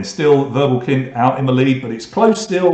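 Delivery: ordinary delivery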